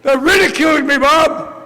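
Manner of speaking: put-on high, loud, whining kid voice; whiny complaint. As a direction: whine to Bob like a hurt child. Phoning sponsor to complain.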